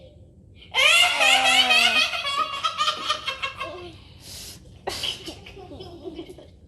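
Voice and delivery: high, loud cackling laugh at own joke; loud shrieking laugh. Real, not acted. Big laugh after own joke.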